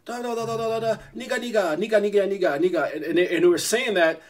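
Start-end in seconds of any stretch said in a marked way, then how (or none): 0.0-2.9 higher babbled mock-foreign chatter; imitating Chinese speakers. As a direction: babble like overheard foreign conversation. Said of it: "they're speaking in their language".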